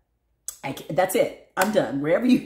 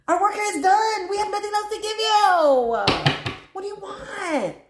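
high, loud acted wail; exasperated outcry; 13 semitones higher, 5 dB louder than usual. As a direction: cry out as a fed-up crowd, hammed up. Voices the collective's exasperated outcry.

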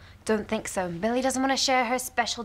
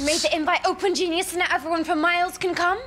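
higher, exasperated telling-off; exasperated scolding; 6 semitones higher, 4 dB louder than usual. Acted. Sister scolds brother for open invite, exasperated and higher. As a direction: berate him for the blunder, pitched up.

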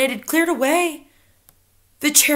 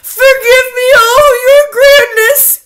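high, loud desperate begging; crying out miserably; 12 semitones higher, 12 dB louder than usual. A narrator's reading. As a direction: beg forgiveness at a piercing pitch. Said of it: "cried the miserable culprit".

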